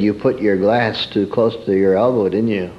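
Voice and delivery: ordinary talk, nothing marked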